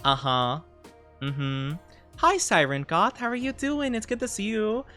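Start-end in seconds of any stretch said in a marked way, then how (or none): none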